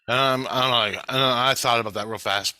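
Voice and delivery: normal talking voice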